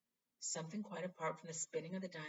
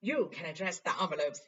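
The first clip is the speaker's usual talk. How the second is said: a louder, showy Lockhart; 10 dB louder than usual. Acted. Vain teacher offering Harry a chore, hammed-up. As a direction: hand out a chore like a treat.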